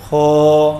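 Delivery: ہو with a smooth clear h and no rasp; clean h. Shown as a demonstration. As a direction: say ہو clean, without grating. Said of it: "اب کوئی خرخرا نہیں ہے".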